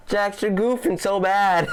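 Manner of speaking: high, mock-scolding jab at a goofball; teasing scold. Commentator teasing the Jack's intern: high mock scold. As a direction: wag a finger at a buddy who blew it, laughing at him.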